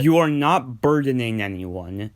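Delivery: ordinary delivery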